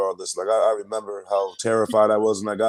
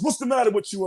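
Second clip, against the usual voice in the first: Drill Sergeant Walsh; 12 semitones higher than usual. Retold or imitated. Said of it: "Drill Sergeant Walsh comes up to me".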